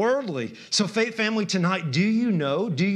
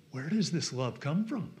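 hushed wonder; 3 semitones lower, 7 dB quieter than usual. Real, not acted. Hushed rhetorical question before outburst.

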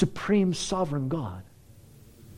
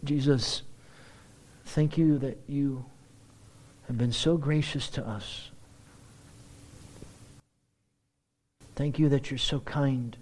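softer, lower prayer voice; quiet prayer; 3 semitones lower, 4 dB quieter than usual. Real, not acted. Preacher praying to Jesus, softer and sincere. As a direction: pray gently to Jesus.

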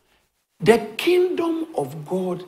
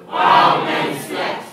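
louder; 7 dB louder than usual. Shown as a demonstration. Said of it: "Say it for the last time".

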